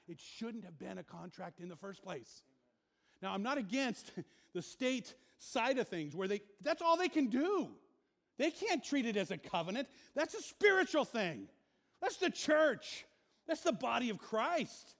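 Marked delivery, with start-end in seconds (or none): 3.2-14.9 loud, high impassioned sermon; full-force preaching. Acted. Pastor preaches against divorce courts, loud and high. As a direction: preach it hard, building heat.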